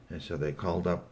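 plain reading voice

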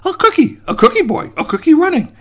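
loud, high-pitched marveling; amazed. Acted. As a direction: exclaim in mounting disbelief. Raccoon amazed at running cookie.

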